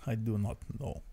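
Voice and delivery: soft, unfulfilled voice; quietly indecisive put-on voice; indecisive guy. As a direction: trail it out with no conviction. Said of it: "he's an indecisive, unfulfilled guy".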